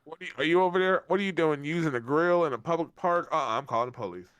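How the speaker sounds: high, loud scolding; hammed-up Karen complaint; mock Karen. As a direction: call out the neighbor like a busybody. Playing Karen at park.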